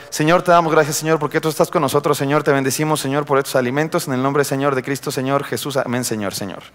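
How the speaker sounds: monotonous mechanical prayer. Acted. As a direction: rattle through a prayer on autopilot, fading out. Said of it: "Hay gente que así ora". (oración monótona y mecánica, apagándose)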